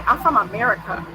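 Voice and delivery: everyday American pronunciation; American accent. Shown as a demonstration. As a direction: say it as an American would. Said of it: "I don't say".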